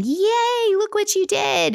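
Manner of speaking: high, squealing "yay" in a hammed-up celebration; mock excited cheer. Parent cheering a kid's first deer, mock excited and high. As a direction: gush like a proud parent overdoing the cheer.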